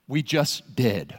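low, slow deadpan "we just did"; deadpan reply. Acted. Coaches answering the confused pilgrim, deadpan. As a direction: drop it flat and final. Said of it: "they all look at you and go".

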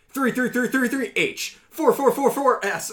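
high, fast frantic counting; frantic button mashing. Retold or imitated. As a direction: rattle off the key presses in a rush. Mimicking frantic T9 typing.

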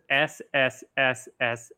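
long hissing s; drawn-out s like a snake. Shown as a demonstration. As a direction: hiss it out like a snake. Saying the title SSSSSSS.

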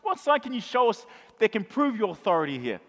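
rising to an angry shout; demanding crowd. Acted. Temple crowd demanding Jesus prove his authority, shouting. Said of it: "and they say".

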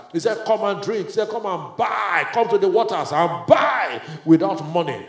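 lifted voice with a loud shout on come to the waters; rising preacher's call. Acted. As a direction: preach the invitation, building.

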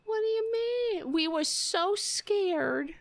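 high, slow sarcastic mock fright; mock scared voice. Mocking the politician, high sarcastic fake fear. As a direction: sneer at her fear in a high voice.

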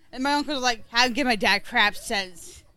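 ordinary delivery

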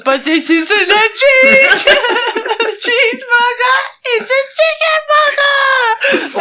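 high, loud, slow sobbing laugh; laughing wail. Acted. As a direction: wail it through laughter, half crying. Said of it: "And then he goes".

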